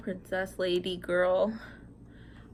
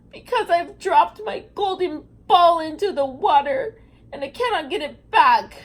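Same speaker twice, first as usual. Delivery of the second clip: mock sobbing; 7 semitones higher, 7 dB louder than usual. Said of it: "sobbed the princess".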